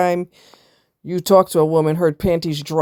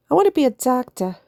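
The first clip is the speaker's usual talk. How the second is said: imitating the nurse, pitched higher; squeaky retold nurse line; high mock nurse voice; 7 semitones higher, faster than usual. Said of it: "He sounds Italian".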